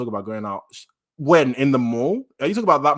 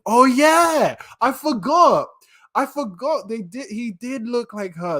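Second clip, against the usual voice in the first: high, loud burst easing into wonder; excited realisation; 11 semitones higher, 6 dB louder, slower than usual. Real, not acted. Reader remembering Deku's look-alike, excited realisation. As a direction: cry out as it suddenly comes back. Suddenly remembers the first love.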